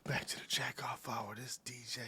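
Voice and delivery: hushed radio-host whisper; joking whisper. Pretend. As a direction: whisper it like a late-night show intro, kidding. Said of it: "You gotta whisper, man".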